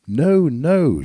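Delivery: loud, high "No, no"; cried out. A narrator's reading. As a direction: cry it out, refusing. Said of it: "she cried".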